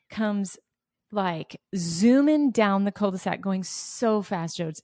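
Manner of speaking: ordinary delivery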